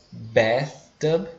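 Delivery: bathtub with an E sound, like "bethtub"; E vowel. Shown as a demonstration. Teacher shows the first variant, slow E vowel. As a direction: say bathtub with an open E in bath. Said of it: "a pronúncia pode ser um som de E".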